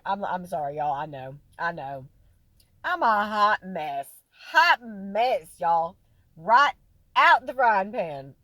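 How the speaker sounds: loud, slow hammed-up "hot mess" confession; mock loud apology. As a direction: belt it out, playfully overdone. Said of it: "And I'm like".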